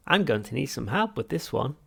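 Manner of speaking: loud, clipped-down businesslike voice; all business now; terse machine. Acted. Machine getting serious about tea, terse. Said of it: "said the machine tersely".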